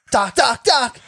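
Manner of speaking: high, loud, urgent calls rising to a shout; excited movie-hero impression; Marty McFly. Host imitating film character calling Doc, urgent high shout. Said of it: "the Marty McFly voice".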